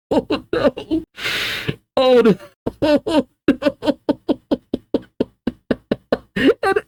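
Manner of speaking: very high, squeaky with laughter; real helpless laughter. Real, not acted. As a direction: laugh through the words, struggling to narrate. Reader cracks up at the blunder.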